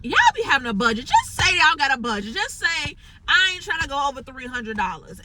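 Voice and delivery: fed-up lecture to a client with no budget; exasperated scolding. Real, not acted. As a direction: call them out with attitude.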